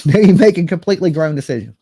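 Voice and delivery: laughing. Real, not acted. Warning parents about grown kids, laughing loudly. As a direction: laugh it out as you speak.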